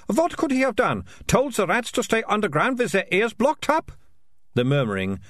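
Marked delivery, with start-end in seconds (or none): 0.1-3.9 high, mocking, carrying voice; mock the piper for the crowd; loud sarcastic girl. A narrator's reading. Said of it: "said Militia, loudly".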